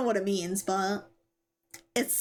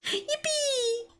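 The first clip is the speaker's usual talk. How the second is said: excited cheer. Real, not acted. Cheering after cute bunny talk.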